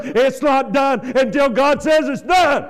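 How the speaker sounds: loud, emphatic preaching cry; shouting. Real, not acted. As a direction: proclaim it with full force.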